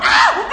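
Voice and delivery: very high, loud shocked cry; mock scream. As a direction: shriek in mock horror. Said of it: "they're like".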